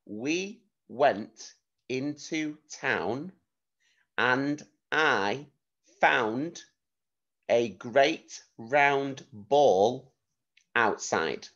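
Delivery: very slow, with gaps between words; slow dictation, pausing after each chunk; slow dictation with pauses. Shown as a demonstration. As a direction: give them time to write each word. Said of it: "I'm gonna say it a few times".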